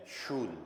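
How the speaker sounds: शूल as sh, long oo, then l; sh-oo-l blend. Shown as a demonstration. Teacher sounding out SCHUL for learners, शूल with sh and long oo. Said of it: "और एल के लिए ल".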